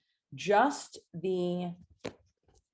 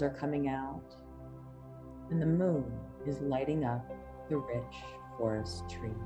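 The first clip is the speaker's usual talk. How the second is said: hypnotic induction; 5 dB quieter than usual. Acted. Reads hypnotic induction over music.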